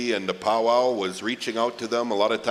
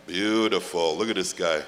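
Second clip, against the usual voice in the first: normal talking voice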